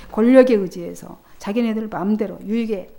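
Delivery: ordinary talk, nothing marked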